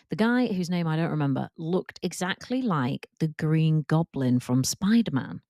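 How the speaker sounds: ordinary talk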